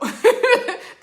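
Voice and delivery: high loud laugh; bright laugh. Real, not acted. Admitting she's freestyling, high loud laugh. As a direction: laugh at herself.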